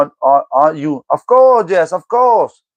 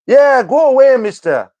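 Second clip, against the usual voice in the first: raised, higher yell telling him to leave; shouted shoo-off; 6 semitones higher than usual. Real, not acted. Streamer yells at a chatter to go away, raised and high. Shooing a troll from chat.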